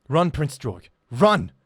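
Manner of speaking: loud, high desperate yell; hollering. Acted. Old priest urging the prince to flee, loud desperate yell. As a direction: scream at him to flee. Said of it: "Old Gomsty hollowed".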